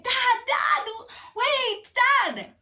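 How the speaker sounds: high calling shout after dad. Retold or imitated. Child chasing her dad, high shouting call. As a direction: yell after him to wait.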